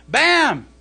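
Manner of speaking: ordinary talk, nothing marked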